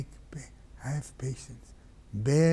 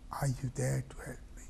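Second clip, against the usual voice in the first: fast, breathless whisper; whispered question; 6 dB quieter, much faster than usual. Acted. Hussein whispering to his silent brother. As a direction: let the call die away. Fading call.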